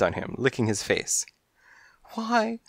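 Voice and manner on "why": much higher, slow motherly fuss; high, slow worried mother voice; fussing mother cat. Acted. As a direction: fuss over the cold baby in a high voice. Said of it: "she hollered at Buster".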